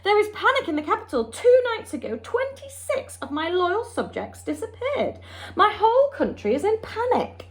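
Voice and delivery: King of Sweden reporting panic; panicking king. A narrator's reading. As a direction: rattle off the bad news in alarm.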